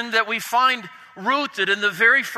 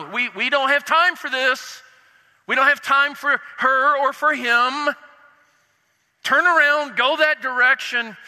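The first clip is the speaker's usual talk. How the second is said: rebuking; 4 semitones higher than usual. Acted. Disciples turning families away.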